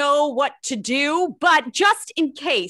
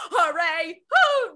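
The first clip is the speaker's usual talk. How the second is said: shouted hooray; 3 semitones higher than usual. Shown as a demonstration. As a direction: cheer it out for the kids.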